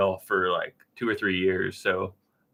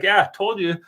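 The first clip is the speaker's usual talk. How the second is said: higher, louder mock yell to the wife; retold yell; 10 semitones higher, 5 dB louder than usual. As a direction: call it out in a mock triumphant yell. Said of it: "yelled at my wife".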